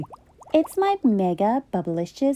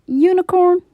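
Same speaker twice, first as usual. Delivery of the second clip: calling out; 6 semitones higher, 5 dB louder than usual. Acted. Donkey calling out louder.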